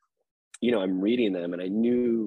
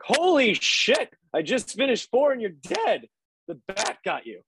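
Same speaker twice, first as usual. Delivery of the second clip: high, excited friend texting in a raised voice; imitating Hamish, much higher and louder, slow on holy shit; impression of Hamish; 12 semitones higher, 5 dB louder, slower than usual. Friend texting the news of her death, high mock voice. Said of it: "You sound just like him".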